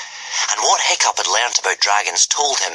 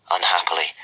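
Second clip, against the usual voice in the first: usual speaking voice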